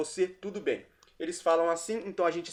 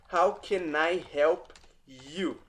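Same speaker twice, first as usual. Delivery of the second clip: slow demo; much slower than usual. Shown as a demonstration. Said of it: "teacher models phrase slowly".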